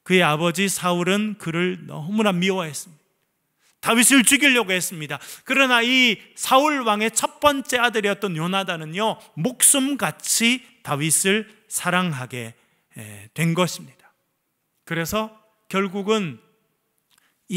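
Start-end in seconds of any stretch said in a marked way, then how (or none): none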